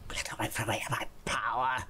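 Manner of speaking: a creepy whisper of power; menacing whisper. Pretend. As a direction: hiss it like a jealous villain. Menacing in-game boast.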